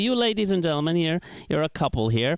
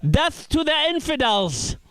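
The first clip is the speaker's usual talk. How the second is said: high shouted razor battle cry; mock yell; 10 semitones higher than usual. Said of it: "the third one yells".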